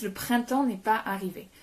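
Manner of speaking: ordinary talk, nothing marked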